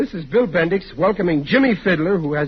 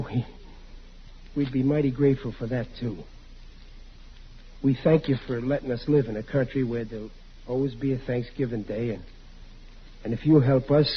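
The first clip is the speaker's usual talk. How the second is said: soft, lower, halting table grace; humble prayer; 7 semitones lower, 6 dB quieter than usual. Acted. Riley saying grace for the family, soft and humble. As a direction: pray haltingly, humbly, with pauses.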